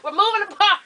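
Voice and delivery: giggling through the words; laughing. Real, not acted. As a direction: laugh while saying it. Laughing at own flub.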